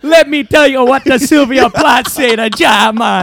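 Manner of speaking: put-on loud, high Jamaican accent. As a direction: shout it in a hammed-up Jamaican accent. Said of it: "we do great Jamaican accents".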